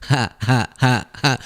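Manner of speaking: spaced-out fake laugh; separate, evenly placed mock ha-has; Howard Lutnick. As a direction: imitate a lone forced laugher in the crowd. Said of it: "This is Howard Lutnick in the crowd".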